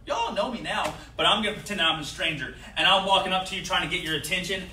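ordinary talk, nothing marked

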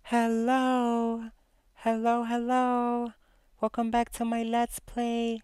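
upbeat YouTuber opener; bubbly let's play intro. Acted. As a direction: greet the audience like old friends, sing-song. Channel intro greeting.

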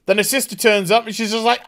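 ordinary delivery

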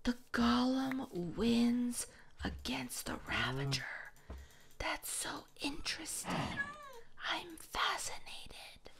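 slow, half-whispered marvelling; hushed fascination. Real, not acted. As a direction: drop to a slow, breath-soft murmur. Hushed reflection after the fight.

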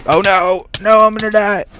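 high, loud cry of panic; panicked yell. Real, not acted. Player about to die in the game, panicked yell. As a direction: yell out in sudden high-pitched alarm.

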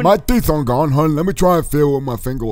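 usual speaking voice

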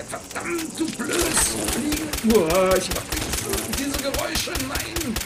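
high, squealing, hammed-up fury at a fly; mock outrage. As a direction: shriek at the pest in comic rage. Raging at buzzing flies.

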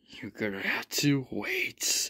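slow, soft unvoiced whisper. Father teasing Jin with a sly whispered secret.

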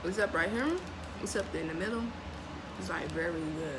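eating chocolate snack; chewing while talking. Real, not acted. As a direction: mouth partly full. Seen 0: Bit bar at -2; holds bitten bar, mouth working while talking.

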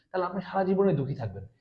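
ordinary talk

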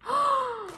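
high, soft gasp; sharp intake of delight; amazed gasp. Real, not acted. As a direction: suck in breath at a surprise. Reacting to crayons in the package.

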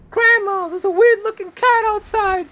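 very high, loud pretend holler; calling to grandma. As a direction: holler to grandma in mock alarm.

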